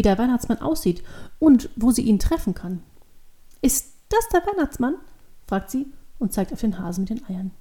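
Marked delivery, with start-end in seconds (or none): 3.6-5.0 put-on very high childlike question; curious child. A narrator's reading. Devil girl points at the Easter hare, curious child. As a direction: ask like a wondering little girl.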